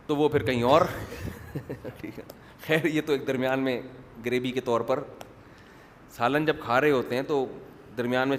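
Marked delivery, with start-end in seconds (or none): none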